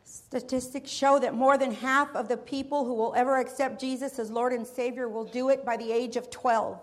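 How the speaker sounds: ordinary talk, nothing marked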